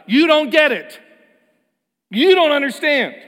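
high, loud imitated scolding; hammed-up condescending man; mock exasperation. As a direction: throw his frustration back at them. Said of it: "saying aloud".

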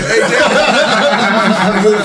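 loud, high, drawn-out mock call; retold calling out. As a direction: call out to a friend, hammed-up and laughing.

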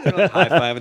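ordinary delivery

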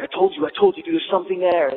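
vindicated and rattled, pressing the point; urgent told-you-so. Retold or imitated. Skater telling his friends he was right, high and urgent. Prankster proven right after second boom.

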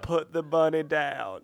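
slow mock Southern Con Air drawl; slow Southern accent like Cage in Con Air. Friend imitates Cage's Con Air Southern accent. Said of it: "I'm Nicolas Cage in Con Air".

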